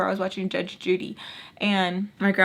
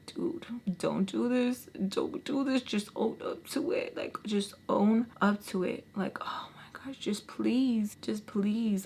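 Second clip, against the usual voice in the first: hushed, softly begging; weary pleading; 6 dB quieter than usual. Retold or imitated. Frustrated woman begging her ex to own up, soft pleading. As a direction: plead under your breath, drained.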